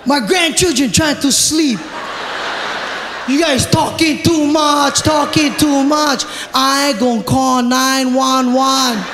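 very slow, drawn-out words; laboured, slow comic dad scolding; dad addressing the deaf. Acted. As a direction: stretch each word out for someone deaf. Dad signing slowly to deaf dealers.

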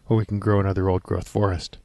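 normal talking voice

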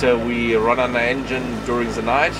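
ordinary talk

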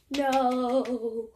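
low, long-held no; drawn-out no. Acted. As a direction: stretch out a low refusal. Rejecting a guess.

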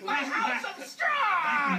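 slow, drawn-out lament; hammed-up pig wail; wailing dismay. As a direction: cry out for your lost house. Pig's house is blown down.

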